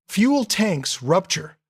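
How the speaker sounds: usual speaking voice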